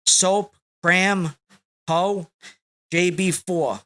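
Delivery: ordinary delivery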